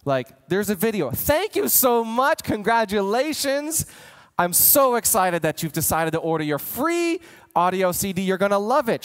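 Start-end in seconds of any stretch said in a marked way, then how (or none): 1.1-9.0 enthusiastic thanks. Shown as a demonstration. Coach demos a thank-you video to new subscribers, gushing. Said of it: "Show enthusiasm".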